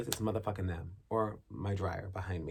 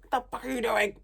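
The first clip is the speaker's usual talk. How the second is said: mock yell; 6 dB louder than usual. Repeated yell at neighbors.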